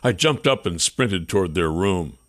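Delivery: ordinary delivery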